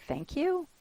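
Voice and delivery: sarcastic disbelieving thanks to sister; rising mock-grateful question; incredulous thanks.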